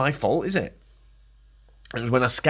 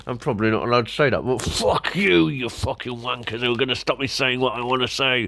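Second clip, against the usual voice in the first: normal talking voice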